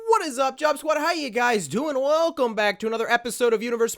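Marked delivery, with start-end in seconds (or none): 0.1-1.7 excited shout. Real, not acted. Greeting the Job Squad, a high excited shout. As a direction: shout hello to the viewers. YouTuber greeting his viewers.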